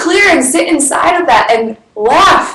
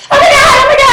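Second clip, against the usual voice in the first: put-on gushing LA girl; 5 dB louder, much faster than usual. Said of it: "you would have been like".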